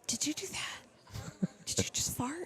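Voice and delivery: a mock whisper on the plane; retold whisper. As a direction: hush it like a neighbour might overhear on a plane.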